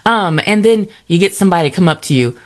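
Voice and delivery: ordinary talk, nothing marked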